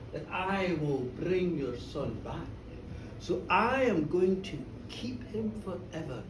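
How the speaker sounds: low, soft, slow villain; trickster Gruagach, menacing. Acted. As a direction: reveal the trick with quiet, deliberate menace.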